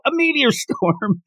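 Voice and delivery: high excited announcer call; announcer yell. Retold or imitated. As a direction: announce it to the whole stadium. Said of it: "President Mike yells out".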